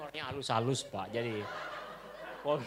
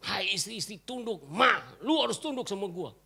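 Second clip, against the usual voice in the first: mock bossy husband; 5 semitones higher than usual. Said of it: "Oh, Alkitab bilang".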